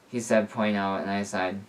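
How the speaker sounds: ordinary delivery, nothing marked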